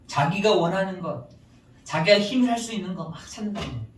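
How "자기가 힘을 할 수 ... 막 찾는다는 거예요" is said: ordinary talk, nothing marked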